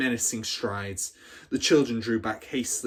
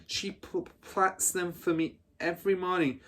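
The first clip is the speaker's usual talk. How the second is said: higher, small, stammering voice; a scared girl answering the headmistress; frightened stutter; 7 semitones higher than usual. Acted. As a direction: stumble over the words, terrified. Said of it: "managed to stutter".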